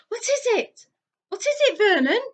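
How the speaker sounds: high, loud rising panic; shrill plea to her husband; aunt begging her husband to explain the noise; panicked aunt. A narrator's reading. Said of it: "gasped Aunt Petunia".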